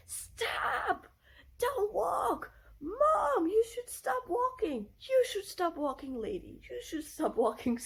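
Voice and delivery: mock alarmed kid ordering everyone to stop; imitated bossy little kid. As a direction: cry out urgently like a small child on traffic patrol. Acting out kid policing red light.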